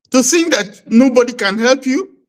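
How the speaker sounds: ordinary talk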